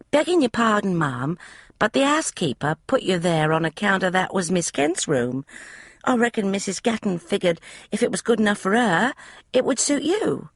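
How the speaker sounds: put-on high, eager young maid's voice; a chatty servant girl, pitched high; maid Polly. Maid Polly explaining the room to Emma, high eager voice.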